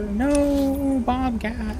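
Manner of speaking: squeaky high mock protest; silly high voice. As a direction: go way up, goofy and teasing.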